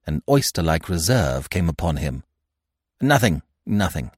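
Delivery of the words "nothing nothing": quick evasive brush-off; hasty. A narrator's reading. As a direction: wave it away in a hurry. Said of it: "he said hastily".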